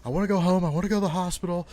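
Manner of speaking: high, loud, rushed-sounding panicked pleading, imitated; mock freaking out. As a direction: act out a kid convinced he's doomed. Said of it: "and I'm freaking out".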